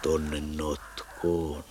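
drawn-out slow "Tonne notkoon"; quoting a hunter. Retold or imitated. Narrator repeating the hunter's words, very slowly drawn out. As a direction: stretch "Tonne notkoon" out lazily, word by word.

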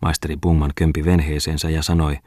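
usual speaking voice

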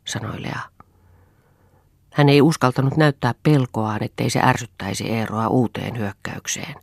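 usual speaking voice

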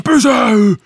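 high, loud stop command; retold police shout. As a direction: yell after the runner. Calling after fleeing suspect.

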